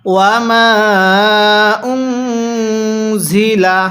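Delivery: very long ওয়ামা, then humming "un" in উনজিলা; long madd, ghunnah. Shown as a demonstration. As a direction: chant slowly, holding the aa and nasal n. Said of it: "গুনাহ করে পড়ব". (তিন আলিফ টান, তারপর নুনে গুন্নাহ)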